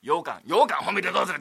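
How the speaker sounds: usual speaking voice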